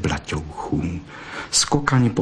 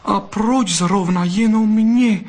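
touched vixen; 12 semitones higher, 4 dB louder, slower than usual. Acted. Moved vixen asks the fox.

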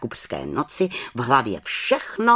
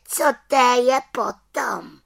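little witch, dismissive; 13 semitones higher, 5 dB louder than usual. A narrator's reading.

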